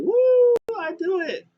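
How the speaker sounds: high, loud whooping cheer. Retold or imitated. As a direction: squeal it out like a thrilled celebrity. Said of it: "He was like".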